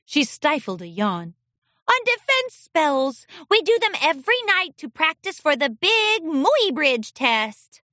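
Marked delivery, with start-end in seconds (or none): 1.9-7.8 high, sleepy little filly; a tired filly explaining; young filly voice. A narrator's reading.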